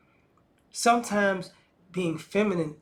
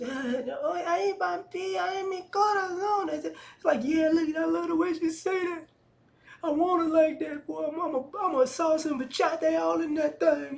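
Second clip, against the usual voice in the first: much higher, sassy bragging voice; 10 semitones higher than usual. Retold or imitated. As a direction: strut it in a high, flirty brag. Imitating Spanish women bragging.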